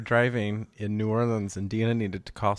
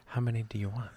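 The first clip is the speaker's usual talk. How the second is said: hushed cozy whisper; 5 dB quieter, faster than usual. Pretend. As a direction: whisper it by the fire. Whispered line in fireside bit.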